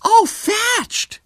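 very high, loud squeal of delight; thrilled shriek from an American lady; ecstatic little scream. A narrator's reading. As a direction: squeal it out in rapture. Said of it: "gave a little scream of ecstasy".

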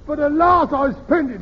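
high, loud imitation of a cry in sleep; mock sleep-talker cry. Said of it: "he shouted out".

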